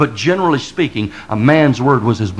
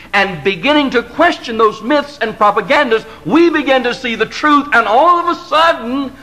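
fiery preaching; 9 semitones higher than usual. Acted. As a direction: build the crowd up to a climax. Builds into full fiery preaching.

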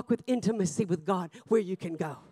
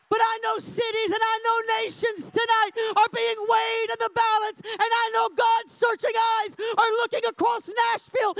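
full-force preaching; 11 semitones higher than usual. Real, not acted. Preacher challenging congregation to hear him tonight, full-force shout. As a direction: cry it out to rouse the room.

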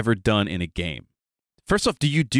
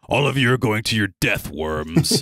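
arrogant dragon-souled dagger; haughty villain talking down to everyone; obnoxious, contemptuous. Acted. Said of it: "speak like an asshole".

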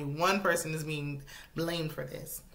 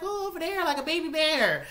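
much higher, sing-song imitation of her; imitating the wife cutting off his poem, pitched way up; high mock excitement; 10 semitones higher than usual. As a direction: squeal it like spotting something cute. Voicing her interrupting again.

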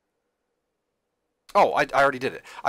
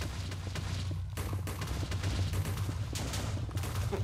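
1 wheezing silent laugh; 7 dB quieter than usual. Real, not acted. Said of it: "I can't even breathe".

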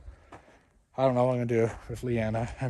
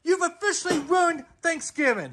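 very high, shouted accusation with ruined hammered; dad shrieking at the kid; furious scream; 13 semitones higher than usual. Acted. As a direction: scream it like everything is over. Screaming at kid over plates.

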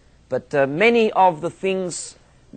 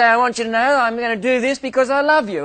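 higher, louder, faster father line; mock stern father; 6 semitones higher, 4 dB louder, faster than usual. Father explaining the spanking to his son, mock stern. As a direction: play the earnest dad.

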